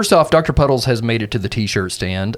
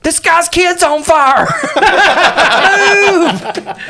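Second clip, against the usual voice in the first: very high, loud yell to a crowd; drawn-out, high-pitched comic shout; shouted warning; 6 dB louder, much slower than usual. Acted. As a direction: holler the alarm and clear the way.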